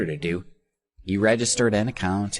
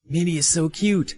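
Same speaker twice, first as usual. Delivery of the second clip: high-pitched fan cry; admiring shout; 10 semitones higher, 4 dB louder than usual. A narrator's reading. Fan shouting from the corridor, high admiring cry. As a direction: call out adoringly from down the hall. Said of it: "a shout came from the corridor".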